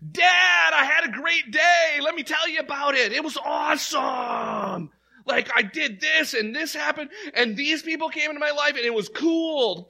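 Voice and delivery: high, loud, gushing excitement; hammed-up, high-pitched elation; excited kid telling Dad. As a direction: shout the good news to Dad in a rush of joy. Acts out excited prayer to Dad.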